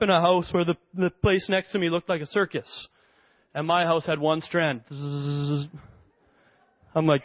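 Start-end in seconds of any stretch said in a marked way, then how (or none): 4.9-5.7 low, soft buzzing noise; electric buzz. Retold or imitated. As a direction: imitate a lone strand buzzing. Imitates one strand of lights.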